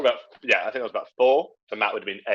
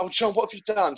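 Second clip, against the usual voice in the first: mock dismayed mum; 9 semitones higher, 5 dB quieter than usual. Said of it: "she was like".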